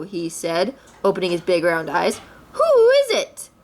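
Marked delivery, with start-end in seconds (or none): 2.5-3.5 much higher, louder hoot-like question; startled Owl. A narrator's reading. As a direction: Owl woken from his snooze. Owl woken by crash.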